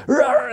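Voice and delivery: mock growl. Scaring dogs with wolf mask.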